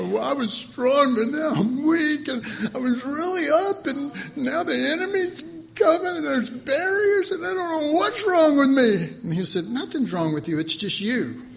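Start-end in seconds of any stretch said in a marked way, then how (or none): 0.0-9.1 high, whining voice of a weak believer, rising to a cry; whiny believer. Acted. Preacher voicing a defeated believer, high and whiny. 9.7-11.4 a hint of laughing, teasing answer; amused reply. Jesus voiced answering with laughs.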